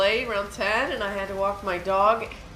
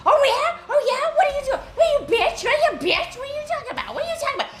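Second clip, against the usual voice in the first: high, loud mock rant; ranting like a furious stranger; imitated angry driver; 5 dB louder, much faster than usual. Driver yelling insults at the dog walker, high mock rant.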